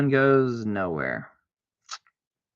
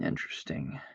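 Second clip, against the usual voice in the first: breathy low whisper; whispered interesting; 10 semitones lower, 11 dB quieter than usual. Real, not acted. Eyeing the cards in a hushed whisper. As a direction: mutter it to yourself.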